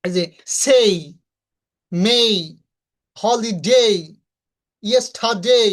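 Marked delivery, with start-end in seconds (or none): 0.6-3.9 সেই, মে, হলিডে each stretched to a gliding "ei"; louder drill, drawn-out "ay" in মে and হলিডে; long EI ending. Shown as a demonstration. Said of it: "A Y থাকলে". 4.8-5.6 ইয়েস্টারডে as "yes-tha-dei"; louder ইয়েস্টারডে with rising long end; silent R, EI ending. Shown as a demonstration. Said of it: "আমরা কি, কি বলবো".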